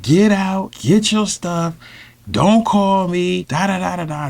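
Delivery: high, slow, imitated angry orders; pissed off. Mother throwing her lover out, imitated high anger. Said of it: "she instantaneously got pissed off".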